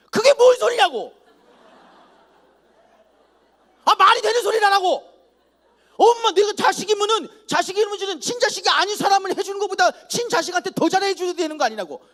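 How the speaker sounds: talking back. Retold or imitated. Teen son yelling back at his mother over sneakers, indignant. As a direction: yell your grievances at your mother.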